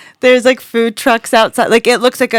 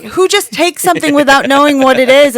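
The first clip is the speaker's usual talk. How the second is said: higher, louder mock scolding; scolding friend; 6 semitones higher, 6 dB louder than usual. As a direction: scold him for the pill. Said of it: "she was like".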